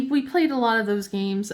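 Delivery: normal talking voice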